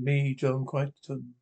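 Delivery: plain reading voice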